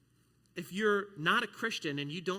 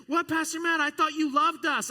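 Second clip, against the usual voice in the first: high, loud whiny student voice; mock-hurt teenager; 13 semitones higher, 11 dB louder than usual. Student loudly guilt-tripping the pastor, mock-hurt. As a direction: play the wounded teen guilt-tripping.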